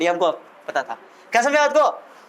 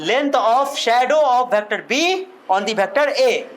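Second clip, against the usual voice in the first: very slow, each word set apart; slow dictation; much slower than usual. Shown as a demonstration. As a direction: read it out for the class to copy. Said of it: "पढ़ दो। बोलो".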